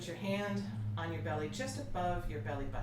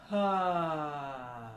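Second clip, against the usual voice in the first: full supported ah, louder; 10 dB louder than usual. Shown as a demonstration. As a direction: push a strong ah from the core. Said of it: "Bring the sound from the strength of those muscles".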